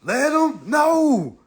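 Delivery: very slow, stretched Let 'em know; slow rallying call Let 'em know; drawn-out let 'em know. Shown as a demonstration. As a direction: lean on each word of Let 'em know, slowly. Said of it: "Tell 'em, let 'em know".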